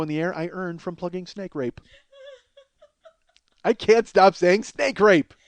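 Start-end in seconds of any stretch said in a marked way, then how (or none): none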